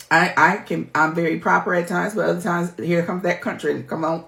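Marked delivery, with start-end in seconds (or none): none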